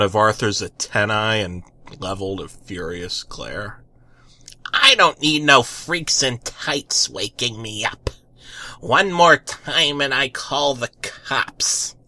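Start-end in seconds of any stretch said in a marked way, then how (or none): 4.7-12.0 menacing. Acted. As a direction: threaten him under her breath. Furious neighbor threatens.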